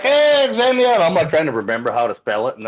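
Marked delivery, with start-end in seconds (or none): 0.0-0.9 calling out. Retold or imitated. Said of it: "I was like".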